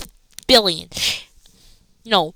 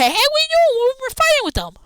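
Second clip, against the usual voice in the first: much higher mock voice, indignant and whiny; Logan Paul; 13 semitones higher than usual. Logan Paul yelling at his tag partner, high mocking voice. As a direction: squeak it up like a baffled partner. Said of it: "but Logan Paul said".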